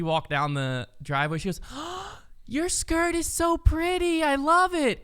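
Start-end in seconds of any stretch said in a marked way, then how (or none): 1.7-2.4 a sharp, high little gasp of delight. Retold or imitated. Aunt voicing niece gasping at a little girl's skirt. Child gasps at pretty skirt. 2.5-5.0 put-on high, slow gushing child voice; excited little girl. Aunt imitating niece complimenting a shy girl, high and gushing. Voicing five-year-old niece admiring skirt.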